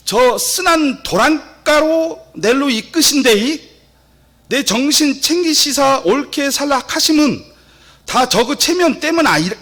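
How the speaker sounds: loud, high-pitched Gyeongsang dialect recitation. Shown as a demonstration. Reciting Psalm 23 to the congregation, broad Gyeongsang dialect. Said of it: "경상도 버전 한번".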